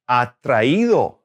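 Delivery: slow "atraído", leaning on the í; stress on the accented í. Shown as a demonstration. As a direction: draw out "atraído" with the weight on the í. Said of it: "maintain the right stress and the right syllable".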